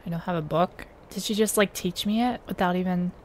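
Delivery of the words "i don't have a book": ordinary talk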